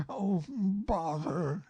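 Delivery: high, slow, drawn-out groan of annoyance; slow bothered bear. Acted. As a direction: stretch it out, fed up. Pooh voice slow cry.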